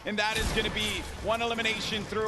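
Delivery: high, hyped esports caster call; real rising excitement over the match; excited play-by-play. Real, not acted. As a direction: drive the commentary upward to a peak. Live play-by-play calling.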